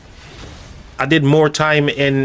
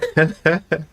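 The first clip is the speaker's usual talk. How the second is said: amused laugh; 4 semitones higher than usual. Real, not acted. Laughs at Irish lineage comment.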